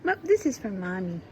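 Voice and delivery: ordinary delivery, nothing marked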